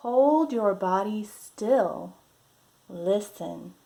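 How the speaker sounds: lively, drawn-out picture-book voice; reading to children. Acted. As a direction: read it like a storybook for little ones.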